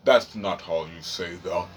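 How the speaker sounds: deep, soft, slow mock of a fussy corrector; mock correcting voice. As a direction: drawl it like a prissy know-it-all.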